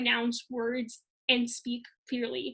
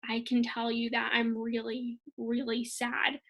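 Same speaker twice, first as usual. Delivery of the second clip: softer and slow, "really, really sad" sinking down; slow and serious; 4 dB quieter than usual. Shown as a demonstration. Teacher demonstrating a sad tone to the class, slow and soft. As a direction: let it drop, heavy and slow. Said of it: "if I slow it down and I get more serious".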